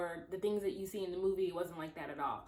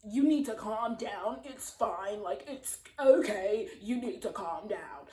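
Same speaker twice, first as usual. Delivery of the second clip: rude; 4 dB louder, slower than usual. Retold or imitated. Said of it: "he gets, like, kinda rude".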